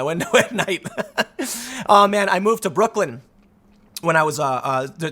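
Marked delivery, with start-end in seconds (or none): none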